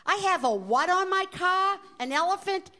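high, loud disbelief; can't believe what she's hearing; shocked waitress repeating the absurd news; incredulous. Retold or imitated. Waitress hears about elephant.

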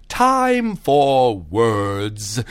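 drawn-out magical announcement; fairy godmother. A narrator's reading. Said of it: "the fairy godmother said".

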